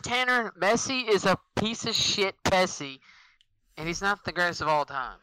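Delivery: high, loud furious yelling; angry shout. Real, not acted. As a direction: blast it out in outrage. Heated rant against Messi.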